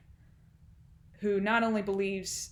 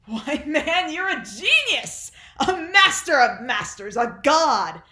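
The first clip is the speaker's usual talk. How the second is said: loud, high mock acclaim; sarcastic exclaiming; 8 semitones higher, 10 dB louder than usual. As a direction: proclaim his genius in mock wonder. Sarcastic praise of son in monologue.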